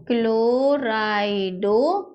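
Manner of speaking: very slow, long-held syllables; slow dictation. Shown as a demonstration. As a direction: draw each syllable out as you write. Dictates the name while writing. (धीरे-धीरे, खींचकर लिखवाना)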